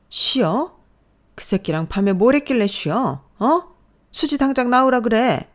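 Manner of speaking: high, loud and scornful; angry mother-in-law yelling; furious yelling. Acted. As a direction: scream her demand. Mother-in-law rages at daughter-in-law.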